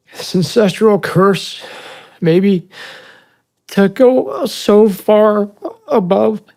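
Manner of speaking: slow, loud, high crying voice; real anguished self-blame, long pauses; tearful. Real, not acted. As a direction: force the words out through tears, halting. Tearful self-blame after breaking down.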